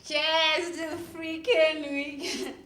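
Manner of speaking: slow, stretched-out words; drunken toast. Acted. Toasting alone after the breakup, drunk. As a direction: drag it out, tipsy and tearful.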